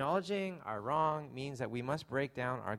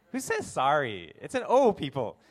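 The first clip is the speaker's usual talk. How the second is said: mock indignation; 3 semitones higher, 10 dB louder than usual. Preacher teasing the congregation over pronunciation, mock indignant. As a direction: scold them in mock outrage, voice leaping up.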